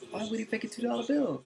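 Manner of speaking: high-pitched disbelieving question, acted out; mock incredulous. Security officer questions the manager about the bill, mock incredulous.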